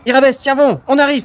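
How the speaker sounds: high, loud call from afar; urgent call. Acted. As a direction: yell encouragement across the battlefield. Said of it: "fait Anevia".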